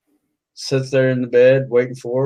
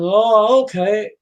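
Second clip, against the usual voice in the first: mixed feelings; 7 semitones higher, slower than usual. Acted. Customer reluctantly accepting her explanation, mixed feelings. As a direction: accept it uncertainly. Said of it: "kind of with mixed feelings".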